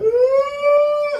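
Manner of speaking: high far cry ah; mock child wail. Comic girl crying in church, high wail. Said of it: "She was like".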